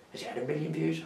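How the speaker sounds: ordinary talk, nothing marked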